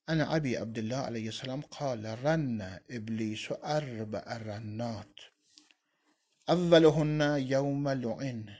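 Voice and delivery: slow, measured Arabic reading; hadith recitation. Acted. Teacher reading the hadith to his students, slow measured recitation. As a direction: read the hadith out slowly and solemnly.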